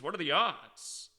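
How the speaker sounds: ordinary talk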